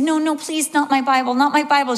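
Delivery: re-enacted high-pitched begging; pleading. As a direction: plead like a thirteen-year-old guarding a treasure. Child begs to keep her Bible.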